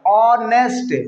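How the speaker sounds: ऑनेस्ट opening on a clear ऑ vowel; silent h. Shown as a demonstration. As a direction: stress the ऑ sound at the start of ऑनेस्ट. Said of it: "आ की आवाज आ रही है". (ऑनेस्ट को ऑ की आवाज़ से शुरू करें)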